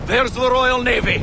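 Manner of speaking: high, loud shout across the deck; battle shout. Acted. Officer spotting the Royal Navy fleet mid-battle, shouting. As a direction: call out the fleet over the battle noise.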